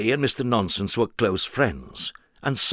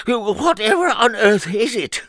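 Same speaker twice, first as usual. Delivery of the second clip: higher, louder sleepy grumble; mock grumble; 12 semitones higher, 6 dB louder than usual. Mister Nonsense woken up, a hammed-up grumble. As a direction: grumble like someone just woken. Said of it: "grumbled Mister Nonsense".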